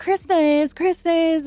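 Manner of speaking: high, eager mock announcement; imitating a friend spilling the news; excited blurt. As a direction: blurt out the secret with glee. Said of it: "one of my friends was like".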